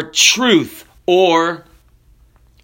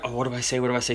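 low, hushed mock panic; frantic muttering to self; 6 semitones lower, 11 dB quieter, much faster than usual. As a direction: mumble it softly, searching for the word. Rider panicking, searching for the word.

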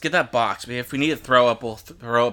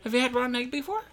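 high teasing voice; 10 semitones higher, 4 dB quieter, faster than usual. Real, not acted. Teasing friend about flavors.

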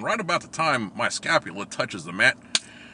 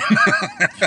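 high burst of laughter; loud laugh; 11 semitones higher, 8 dB louder than usual. Real, not acted. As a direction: break into a laugh. Laughing at the story.

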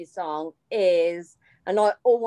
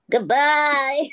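weak, lightly voiced sultan farewell; half-hearted sultan impression; 4 semitones higher, 4 dB louder than usual. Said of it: "say goodbye in your special Sultan voice".

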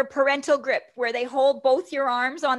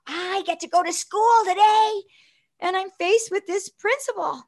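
put-on excited little girl; 6 semitones higher than usual. Five-year-old [name]'s hopes.

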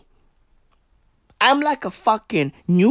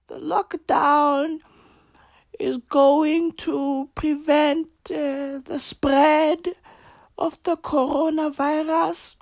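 slow, higher, halting official with many uhs; impression of her slow speech; 5 semitones higher than usual. Mocking the slow-talking minister at a briefing, slow halting drone. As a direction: drawl it out like a tired minister. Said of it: "That's how she sounds all the fucking time".

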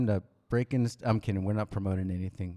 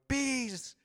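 high, squeaky movie line; Tommy Boy quote. Retold or imitated. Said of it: "Tommy Boy".

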